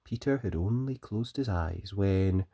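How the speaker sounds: plain reading voice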